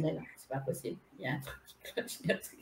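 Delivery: hushed fast whisper, barely voiced; whispered disbelief. Whispering to herself about the strange twin flames. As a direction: breathe the words as a secret. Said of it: "Je me suis dit".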